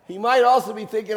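normal talking voice

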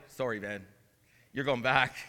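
casually breaking the news, low and quiet; God the Father, casual. Retold or imitated. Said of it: "the Father was like".

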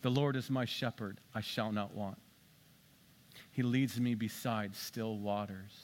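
low, soft, prayerful recital; hushed Lord's Prayer from the pulpit; prayer recitation. Acted. Pastor recites Psalm 23.

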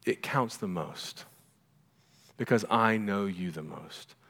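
quiet, measured fatherly assurance; tender reassurance. Acted. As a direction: let it sink in gently. Same words as the Father's reassurance.

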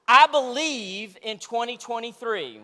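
believe punched loud and slow; stress on believe. Shown as a demonstration. Pastor showing the congregation the mantra, stressing believe. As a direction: lean hard on the word believe, slowly. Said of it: "say that with me".

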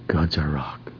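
hushed whisper. Acted. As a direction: breathe it as a secret. Hushed quote, whispered aside.

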